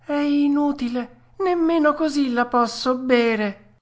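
high-pitched puppet refusal; stubborn whine. Acted. Puppet refusing the glass, high stubborn whine. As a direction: dig in like a balky child, pitched high. Pinocchio still refuses to drink.

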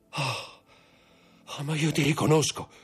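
recognition bursting out of him; dawning recognition. Acted. Villefort recognising Dantès, building to a high cry. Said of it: "disse il procuratore".

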